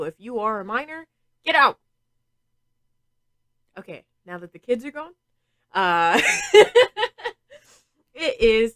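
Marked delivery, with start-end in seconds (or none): 1.4-1.8 loud playful yell; shouting. Acted. Telling minors to leave. 6.2-7.6 bright burst of laughter; high laugh. Real, not acted. Laugh after kids gone.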